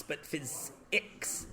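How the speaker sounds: "physics" unvoiced, slow, ending in a clear ix; whispered physics. Shown as a demonstration. Teacher whispering the proper ix ending of physics. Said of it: "with an I X sound".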